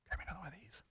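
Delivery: hushed request to the crew; quick whispered aside to a helper. Real, not acted. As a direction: whisper it off-mic.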